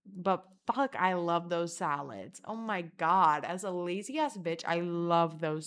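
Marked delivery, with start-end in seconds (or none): none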